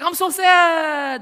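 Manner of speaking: hammed-up wail, loud and slow, high; mock sad. Preacher mocking a shallow child's sorrow, hammed-up loud wail. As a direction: overdo the sadness like a child. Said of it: "Their sorrow can only go so deep".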